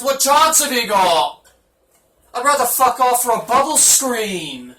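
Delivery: loud, high put-on British voice, drawn out; British accent. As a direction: shout it slowly in a hammed-up British accent. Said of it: "let me get my British voice on".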